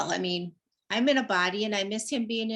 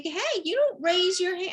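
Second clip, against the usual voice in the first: higher, mock-stern telling off; a hammed-up reprimand to the cat; mock scolding; 9 semitones higher than usual. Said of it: "And I'm like".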